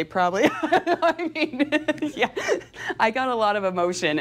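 laughing through the words. Real, not acted. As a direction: chuckle while admitting it. Laughs at own crying.

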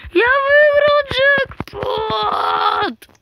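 high, loud triumphant shriek; shrieking jackpot winner. Real, not acted. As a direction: shriek it like you won big. Wins jackpot in game.

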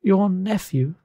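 high, otherworldly ghost voice. Acted. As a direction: speak as a spirit, pitched well above normal. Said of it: "said the ghost".

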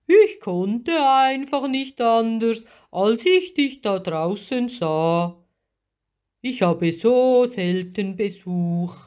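big, strong creature voice, apologetic; drawn vowels, full creature voice; friendly giant octopus. A narrator's reading. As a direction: explain yourself like a lonely sea giant. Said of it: "ein feuerroter Krake".

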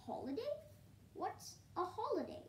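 high-pitched, puzzled questioning; baffled owl, voice lifted high; wondering. A narrator's reading. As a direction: ask as if the word is brand new. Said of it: "wonders the owl".

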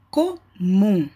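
"comum" split as "co, mum", last syllable held; ênfase na última sílaba. Shown as a demonstration. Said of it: "Essa é a sílaba mais forte". ("comum" separado em sílabas, com "mum" forte)

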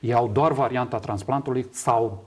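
ordinary talk, nothing marked

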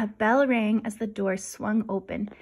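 ordinary delivery, nothing marked

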